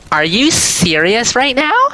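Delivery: very high, slow mock outrage; incredulous. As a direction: imitate an appalled girl, drawing it out high. Shocked girl confronts him.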